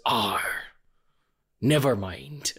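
deflated pirate. Retold or imitated. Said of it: "he's a pirate".

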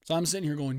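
ordinary talk, nothing marked

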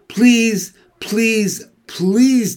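high, spaced-out begging; desperate pleading. A narrator's reading. Wilbur begs Templeton for the egg sac, pleading high. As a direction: beg harder with each separate word.

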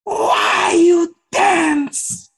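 very high, slow, stretched-out shout; hammed-up hotheaded teacher bellow, every word dragged; imitated yelling. Angry teacher challenges silent dancers, imitated drawn-out yelling. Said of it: "He just kept yelling".

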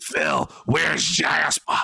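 slow, drawn-out yell for the cat; hammed-up wife shouting from another room. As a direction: call out for Jasper like a worried wife. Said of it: "Oh, sorry".

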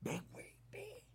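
susurro urgente al amigo. Retold or imitated. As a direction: susurrar para que ella no oiga. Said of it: "Me dice". (el compa susurra junto al teléfono)

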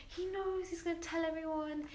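a hint of teary worry as she retells it; retold panic. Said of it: "I'm crying".

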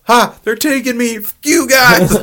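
high, loud shouted movie impression; hammed-up high-pitched shouting; mock yelling. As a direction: yell it like someone being dragged off. Acting out a captured film character.

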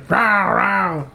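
light imitation monster roar; imitated rap growl-word. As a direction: roar it like a rapper's ad-lib. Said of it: "he goes in the song".

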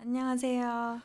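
soft thin greeting; small delicate hello. Real, not acted. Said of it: "가느다란 목소리로 인사하시는데". (가느다란 목소리)

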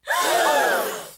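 a sharp, high gasp of disbelief; shocked gasp. Retold or imitated. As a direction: gasp as if the plan just collapsed. Shock at the cheeseburger order.

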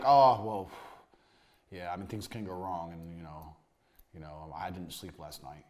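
mock-nervous surgeon mumbling his doubts; nervous doctor.

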